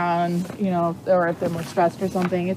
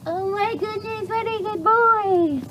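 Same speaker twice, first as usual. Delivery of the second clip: much higher, slow cooing praise; 11 semitones higher, slower than usual. Real, not acted. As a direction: gush over the horse like a proud parent. Delighted praise for the horse.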